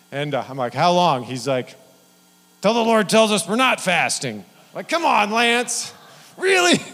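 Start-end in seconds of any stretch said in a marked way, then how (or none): 0.7-1.2 louder, dreading ask; exasperated question. Retold or imitated. Student asking his friend how long the fast lasts, exasperated. 2.6-4.4 higher, louder, put-on cheerful voice; zealous friend. Lance telling his friend how long they'll fast, zealous. 4.7-6.8 much higher and louder, come on; mock exasperation. Speaker complaining to Lance about the endless fast, exasperated.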